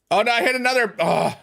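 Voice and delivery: high dismayed cry; high-pitched burst of frustration; frustrated game yell. Real, not acted. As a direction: wail at your own misclick. Misses in the chicken game.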